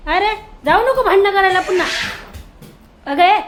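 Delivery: loud, high call to stop the fight; scolding shout. Real, not acted.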